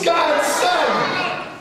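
high, loud call. Acted. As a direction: call it out to the sky. Call response.

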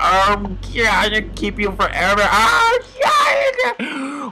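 high, drawn-out cutesy squeal; gushing over pet. Pretend. Player adopts a penguin as his pet, gushing.

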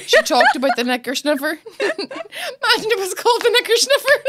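giggling helplessly at own joke; laughing so hard the voice climbs. Real, not acted. Laughing at knicker sniffer joke.

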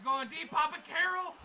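high, loud shout of a name; excited call. Real, not acted. Calls out during deep pass.

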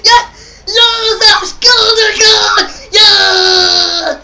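loud, high yells of yes; real victory shouting; triumphant shouts. Real, not acted. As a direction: celebrate the goal at full voice. Scores again, celebrates.

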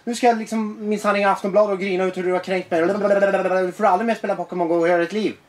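high-pitched, hammed-up outraged rant; mock hitting the roof. Acting out an adult overreacting to a teenager, mock fury. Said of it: "Och gå i taket".

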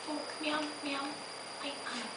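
high, soft, hesitant little voice; adorable customer. Acted. Shy customer asking the bookseller for a book, adorable. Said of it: "She's so adorable".

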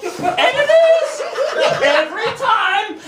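high, loud, drawn-out cry; exultant shout. Acted. Poet proving the point to the audience, exultant shout. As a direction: belt it out slowly to the crowd. Triumphant climax of the example.

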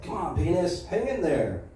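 high-pitched comic pep talk; mock urging. Comic begs his own body to last longer, mock urging.

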